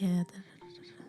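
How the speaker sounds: breathy whispered sexy voice. Retold or imitated. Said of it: "I was like".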